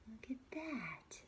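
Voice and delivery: slow hushed whisper. Real, not acted. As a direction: breathe it out in wonder. Aside to listening child.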